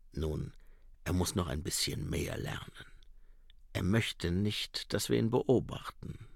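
low, quiet and dry; a tired watchman grumbling; gruff commander. A narrator's reading. Mumm voiced low. (leise, tiefe, trockene Stimme)

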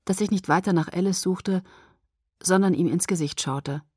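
usual speaking voice

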